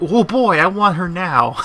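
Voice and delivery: high, eager excitement; excited. Retold or imitated. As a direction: gush with impatience. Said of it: "And I'm like".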